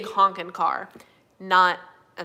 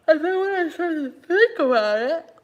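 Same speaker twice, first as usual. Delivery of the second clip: yawning while talking; 8 semitones higher, 7 dB louder than usual. Real, not acted. Yawning mid-sentence.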